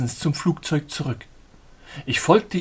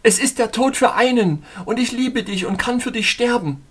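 desperate lover; 9 semitones higher, 5 dB louder than usual. Acted. Lover declares in a falling plane.

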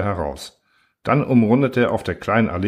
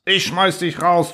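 much higher, slow furious threat; raging; 9 semitones higher, slower than usual. A narrator's reading. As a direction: rage at him. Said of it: "tobte der Ingenieur".

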